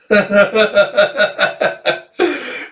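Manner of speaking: high, hammed-up flirty laugh; character laugh.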